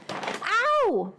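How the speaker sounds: sudden high yelp of pain; real pained ow; sharp ow. Real, not acted. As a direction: yelp as the staple pricks. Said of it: "'cause that hurt".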